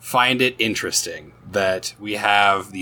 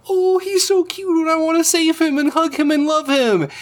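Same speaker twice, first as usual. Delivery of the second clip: put-on high squealing baby-talk gush. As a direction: squeal it like something is unbearably cute. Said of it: "and I go".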